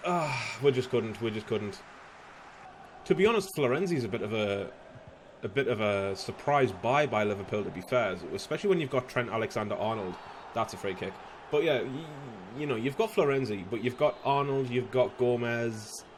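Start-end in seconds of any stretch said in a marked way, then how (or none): none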